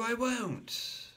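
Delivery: put-on high indignant child; high childish I won't; little boy protesting. A narrator's reading. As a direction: insist like a small boy.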